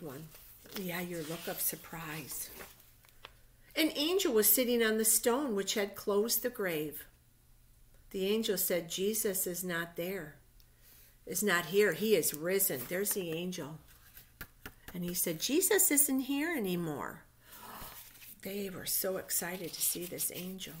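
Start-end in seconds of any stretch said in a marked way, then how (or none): 3.7-12.8 reading a Bible story aloud, louder and measured; story narration. A narrator's reading. Teacher reading Easter story to children, storybook narration. 15.4-17.2 reading a Bible story aloud, louder and measured; story narration. A narrator's reading. Teacher reading Easter story to children, storybook narration.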